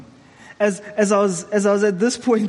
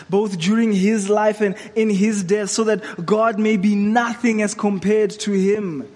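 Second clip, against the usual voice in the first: ordinary talk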